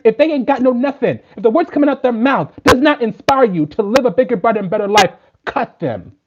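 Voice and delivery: high, loud, fierce sermon to the class; fiery preaching. Acted. Preaching at full force to her class.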